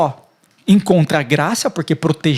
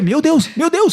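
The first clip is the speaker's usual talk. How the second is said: shocked reader; 10 semitones higher, 4 dB louder than usual. Retold or imitated. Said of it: "você vai lendo e você vai".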